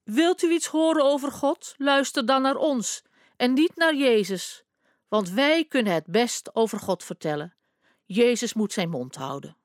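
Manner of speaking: high, haughty posh voice; plummy voice. Retold or imitated. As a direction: imitate the pompous temple bosses. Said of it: "met een deftige stem de bazen na".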